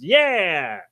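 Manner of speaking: long drawn-out "yeah", swooping up then down; mock fanfare cheer. Podcaster cheers the Capitals' win, mock fanfare cheer. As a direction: give a drawn-out celebratory whoop. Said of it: "fanfare for the Capitals".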